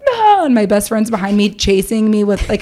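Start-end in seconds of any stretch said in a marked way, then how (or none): 0.1-0.5 high-pitched imitated scream; short high cartoonish shriek; mock scream. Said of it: "see everyone being like".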